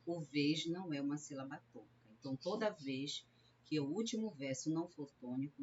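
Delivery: usual speaking voice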